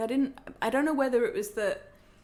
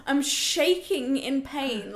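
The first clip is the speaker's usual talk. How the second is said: ordinary delivery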